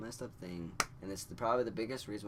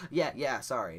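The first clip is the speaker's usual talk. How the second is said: loud, hurried-out apology; flustered reply; 3 semitones higher, 10 dB louder than usual. Retold or imitated. Nervous teen answering his girlfriend's check, loud and flustered. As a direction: blurt it out loudly, flustered.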